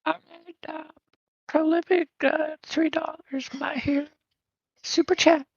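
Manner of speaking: put-on high breathy girl voice; squeaky put-on girl voice. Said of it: "it's a girl's voice, not whispering".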